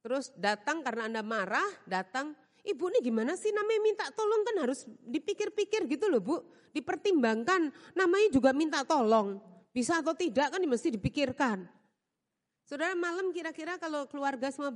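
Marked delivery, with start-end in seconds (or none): 2.7-11.6 cheeky maid lecturing. Retold or imitated. Maid lecturing her employer.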